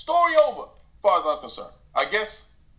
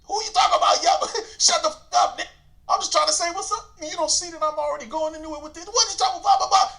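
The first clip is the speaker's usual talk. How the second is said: heated yelling; 10 semitones higher than usual. Retold or imitated. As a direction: go at him, shouting. Heated argument with Yuck Mouth.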